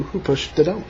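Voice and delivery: ordinary delivery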